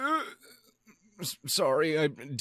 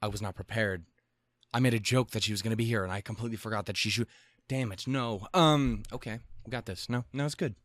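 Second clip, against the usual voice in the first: ordinary talk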